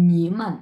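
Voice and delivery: নিমান with a low dipping নি and a light মান; Chinese nǐmen. Shown as a demonstration. Teacher models the full নিমান for learners, dipping then light. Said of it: "একসাথে আমরা কিভাবে উচ্চারণ করব".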